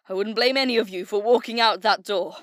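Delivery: loud and fast, firing up the troops; stirring battle speech; rousing rally. Acted. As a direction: push it out like a commander's speech. Leader rallies troops before war.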